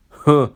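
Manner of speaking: a scornful loud grunt. A narrator's reading. Proud engine grunting in scorn at old Skarloey. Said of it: "grunted Sir Handel".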